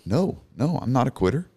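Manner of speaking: high-pitched, pleading denial; tearful protest. Retold or imitated. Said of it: "You know, still crying".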